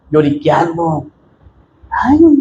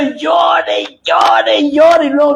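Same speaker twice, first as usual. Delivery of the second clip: mock weeping; 8 semitones higher, slower than usual. Mocking a weeping churchgoer. (llanto burlón exagerado, agudo y fuerte)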